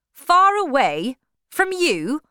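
high, loud, slow rising question; incredulous echo. Acted. Suitor questioning the absent husband, incredulous. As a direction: echo "Far away" back in disbelief. Alec echoes her answer in disbelief.

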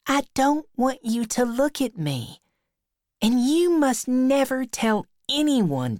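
put-on high, insistent girlish voice; Becky, shy schoolgirl. A narrator's reading. Becky swearing Tom to secrecy, high shy girl's voice. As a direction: speak high as a bashful girl.

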